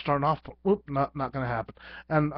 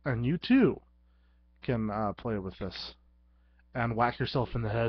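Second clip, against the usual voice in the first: usual speaking voice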